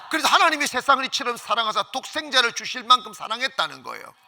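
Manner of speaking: usual speaking voice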